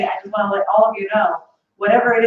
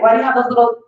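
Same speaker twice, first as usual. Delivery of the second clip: ordinary talk, nothing marked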